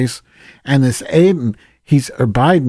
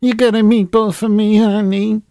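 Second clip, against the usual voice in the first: high, sing-song New Jersey mob-wife voice; New Jersey Sopranos accent; 9 semitones higher than usual. Pretend. Mocking a friend in an exaggerated New Jersey Sopranos accent. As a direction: lay the Jersey accent on thick, flirty and teasing. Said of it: "talk with that New Jersey Sopranos accent".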